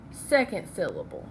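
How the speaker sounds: usual speaking voice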